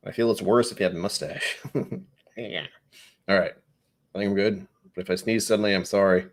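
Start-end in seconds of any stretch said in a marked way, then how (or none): none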